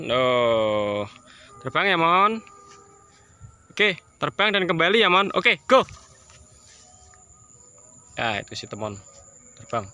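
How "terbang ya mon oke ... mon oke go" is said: cheering release. Real, not acted. Owner releasing his pigeon, high excited send-off.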